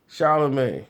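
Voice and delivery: usual speaking voice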